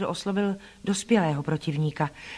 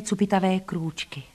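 usual speaking voice

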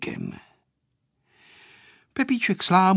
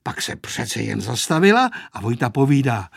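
ordinary delivery

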